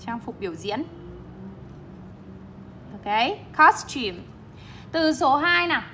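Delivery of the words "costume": costume with COS raised, -tume falling; teacher's model of costume, first syllable stressed. Shown as a demonstration. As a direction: hit COS in costume, let -tume drop. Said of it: "Từ này cô đang nhấn trọng âm một".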